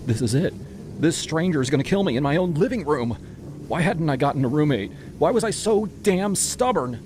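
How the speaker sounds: high, rising despair; panicked inner voice. A narrator's reading. Tenant thinks the stranger will kill her, panicked and despairing. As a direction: think aloud in terror, pitch climbing to the last question. Terrified thoughts while being choked.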